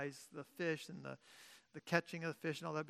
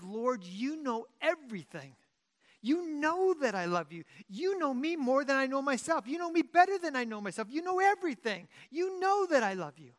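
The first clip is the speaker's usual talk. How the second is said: higher, louder anguished pleading; grieved plea; 6 semitones higher, 5 dB louder than usual. Retold or imitated. Peter pleading his love to Jesus, grieved and rising. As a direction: pour it out, begging to be believed. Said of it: "it says he is grieved".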